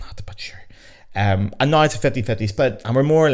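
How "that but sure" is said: breathy whispered throwaway; whispered aside. Real, not acted. Podcaster whispering a wry aside to listeners. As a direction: drop to a whisper for the punchline.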